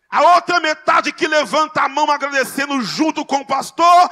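high, loud revival preacher shout; impassioned, booming prophetic declaration; full-force preaching. Real, not acted. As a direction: drive the climax home, shouting to the congregation.

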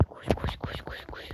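soft unvoiced run, run; whispered urging. Real, not acted. Urging her game character on in a whisper. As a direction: whisper it under your breath.

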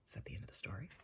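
hushed little whispered question. Real, not acted. Listener asks about the story ending, whispering. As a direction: whisper it so as not to break the story.

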